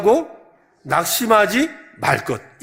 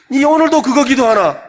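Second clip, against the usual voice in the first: loud, high exasperated neighbour; annoyed friend; 6 semitones higher, 7 dB louder than usual. Acted. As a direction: scold the pestering friend, louder and higher. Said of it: "이래야 된다 이 말이에요". (귀찮은 친구가 높고 크게 핀잔하는 목소리)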